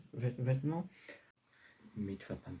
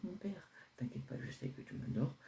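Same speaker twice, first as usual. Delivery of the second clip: coaxing whisper; 8 semitones higher, 6 dB quieter than usual. Acted. Son reassuring father.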